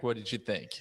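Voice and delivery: ordinary delivery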